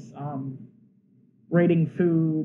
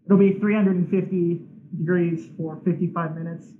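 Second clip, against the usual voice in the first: ordinary talk, nothing marked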